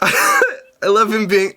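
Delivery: slow, high words broken by laughter; giggling through it; laughing. Real, not acted. Laughing at Tom.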